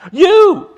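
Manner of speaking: YOU thrown out loud and high; emphatic YOU. Retold or imitated. Peter and John answering the council, loud emphatic stress on "you". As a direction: hit "you" hard, far above the rest. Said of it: "that's emphatic, by the way".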